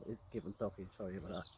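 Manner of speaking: ordinary talk, nothing marked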